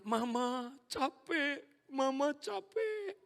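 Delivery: put-on high, slow, whiny child's plea; tired little child. Three-year-old telling mama she is tired, in a high whiny voice. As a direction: drag out a tired kid's complaint. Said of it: "dia bilang".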